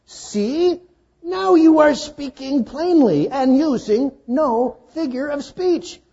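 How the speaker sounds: high, loud, eager disciple voice. Acted. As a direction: play the disciples as overeager followers. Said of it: "his disciples said to him".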